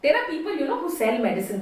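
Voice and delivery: usual speaking voice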